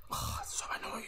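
breath-only, exasperated whisper; soft, voiceless grumble; annoyed whisper. Real, not acted. Worn down by repeated failure.

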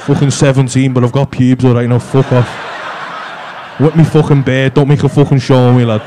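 low, loud gruff lad voice, well below his own; mock deep teen voice. Teasing Liam about his deep voice, mock gruff low voice. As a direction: ham up the kid's deep voice to tease him. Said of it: "You got a fucking deep voice on there".

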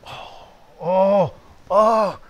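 rising oh of shocked realization; gasping oh as it dawns; dawning horror. Retold or imitated. Realizing his own guilt.

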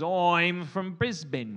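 slow, loud Aussie "Oi'm from Brisbn"; mock Australian accent. Shown as a demonstration. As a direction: show off a stretched Australian accent. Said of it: "Notice I didn't say Brisbane".

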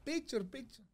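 lightly voiced halting English; broken English. Groom repeating picture to the stranger. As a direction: repeat the foreign word carefully. Handing over the phone.

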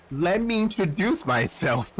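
giggling through the line; can't keep a straight face; laughing through it. Shown as a demonstration. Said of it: "That's not how it works".

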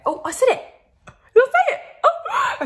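squeaky high surprise at her own success; delighted she finally said it; surprised delight. Real, not acted. Surprised she got the word right.

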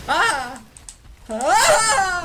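high loud startled cries; startled yelps. Real, not acted. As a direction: yelp in alarm.

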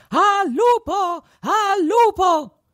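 tiny high voice calling out, slow; a tiny boy in the wolf's belly yelling to the shepherd; shouting. A narrator's reading. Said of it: "ricominciò a gridare". (grida lenta con vocina acuta)

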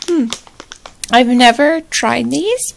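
normal talking voice

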